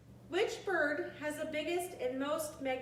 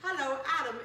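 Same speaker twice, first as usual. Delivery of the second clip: louder, squawky parrot hello; 3 semitones higher, 7 dB louder than usual. Retold or imitated. Parrot greeting everyone who walks in, loud mimicked squawk. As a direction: lightly mimic the parrot again. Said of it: "the parrot says".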